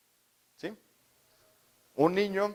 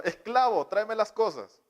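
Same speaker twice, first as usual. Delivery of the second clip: higher, demanding little tyrant ordering a slave; child commanding parent as slave, higher and bossy; bossy child master; 7 semitones higher than usual. Retold or imitated. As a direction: bark orders like a spoiled kid. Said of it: "Muy subjetivamente les están gritando".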